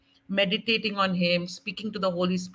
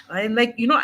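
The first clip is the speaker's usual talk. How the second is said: usual speaking voice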